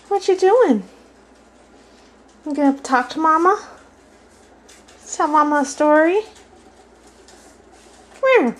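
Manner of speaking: high, sing-song coaxing of a parrot; high pet talk. Real, not acted.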